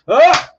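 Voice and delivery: a sharp loud frustrated yelp; sudden loud burst of annoyance; frustrated shout. Real, not acted. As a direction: bark out an exasperated "Ah!". Frustrated outburst.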